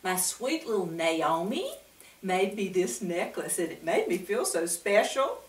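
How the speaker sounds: plain reading voice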